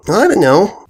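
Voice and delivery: put-on high girlish shrug; young filly voice. A narrator's reading. Apple Bloom speaks.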